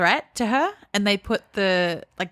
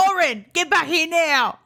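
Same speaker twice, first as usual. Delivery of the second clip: high, sharp mock call to a child; mock mum yell; 9 semitones higher than usual. Fed-up mum calling her kid back, high mock yell.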